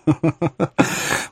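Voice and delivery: acted little royal laugh; king's theatrical chuckle. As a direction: chuckle to yourself as the king. King laughs in the story.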